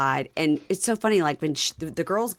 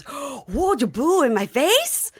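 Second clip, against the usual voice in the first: mock amazement, higher and louder; astonished husband; 9 semitones higher, 4 dB louder, slower than usual. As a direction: gasp it out in disbelief. Husband hearing about strip club.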